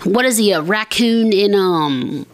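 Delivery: ordinary talk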